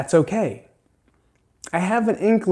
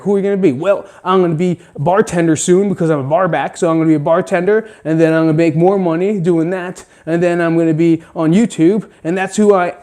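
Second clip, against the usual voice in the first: louder, higher eager bar back voice; mock bar back; 4 semitones higher, 6 dB louder, faster than usual. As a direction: lay out the plan like a hopeful young guy. Mock self-talk example.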